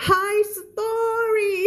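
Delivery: high-pitched, long-drawn "high story"; ครูเพนสี. Retold or imitated. Teacher mimics another teacher's reading of history, high drawn-out impression. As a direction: stretch "high story" out in a high mock voice. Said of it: "อ่านแบบครูเพนสีอ่านว่า".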